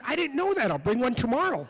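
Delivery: put-on high, innocent little-kid voice; earnest kindergartner eager to please; naive child. Retells his naive childhood reply.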